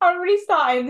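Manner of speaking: high, loud words on the edge of giggles; starting to lose it laughing; real laughter breaking into speech. Real, not acted. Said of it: "I can't stop laughing now".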